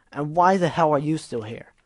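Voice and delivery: loud, forceful questioning; angry demand. Acted. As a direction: demand an answer. Gilda angry.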